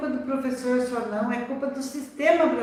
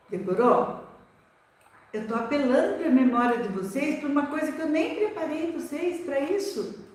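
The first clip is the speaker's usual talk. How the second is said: ordinary talk, nothing marked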